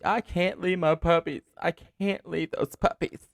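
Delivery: high, slow, frantic wail; imitated screaming woman. Woman refusing to get in without her puppies, mock screaming. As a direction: stretch out the mock-hysterical plea. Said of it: "the woman runs out screaming".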